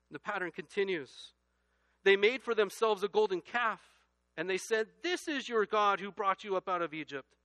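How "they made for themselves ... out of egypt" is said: loud, high scripture reading; preaching at full force. Acted. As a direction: proclaim the verse to the back row.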